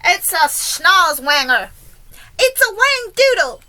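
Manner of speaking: loud, drawn out, leaping high; fire chief's yell. A narrator's reading. Fire chief names the monster, yelling. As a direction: yell the silly names in full alarm. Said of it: "yelled the head of the fire".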